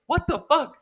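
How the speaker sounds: high-pitched retold bewilderment; shocked disbelief. Recounting the flying lighter to friends, shocked. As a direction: exclaim it high, aghast. Retelling own shocked reaction.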